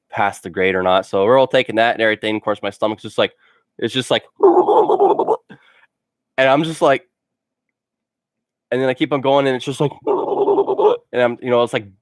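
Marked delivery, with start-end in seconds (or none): 3.8-5.4 a slow, high, gurgling belly noise; stomach gurgle imitation. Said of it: "burbling and gurgling". 9.5-11.4 stomach gurgle imitation. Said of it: "burbling and gurgling".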